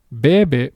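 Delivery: Bebe hit hard on the first "Be"; slow BE-be, force up front; stress on first syllable. Shown as a demonstration. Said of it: "natural pronunciation of this word".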